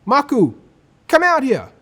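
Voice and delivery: high, loud call from the door. Acted. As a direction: call him from across the house. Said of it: "someone calling".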